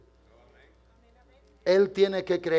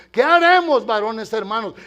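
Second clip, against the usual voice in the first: pleading cry; 2 semitones higher, 7 dB louder than usual. Retold or imitated. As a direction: cry out for an answer. Crowd asks Peter in Jerusalem.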